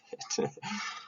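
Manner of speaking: soft unvoiced chuckle; little self-mocking laugh; breathy laugh. Real, not acted. Teacher chuckling at his own fear of dying alone.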